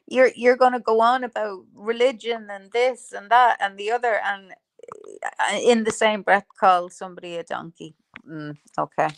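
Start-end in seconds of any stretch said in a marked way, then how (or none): none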